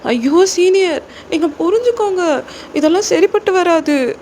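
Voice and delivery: put-on high-pitched, pleading girl character; distressed junior girl. Junior girl begging senior to let her go, distressed pleading. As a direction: beg desperately in a high voice.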